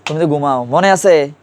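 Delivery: ordinary talk, nothing marked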